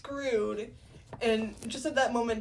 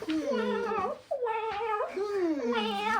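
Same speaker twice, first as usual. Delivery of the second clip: high mock whimpering wail; mock crying; 10 semitones higher than usual. Desdemona crying before Othello, mock whimpering. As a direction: whimper in badly faked sobs. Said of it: "Desdemona starts crying".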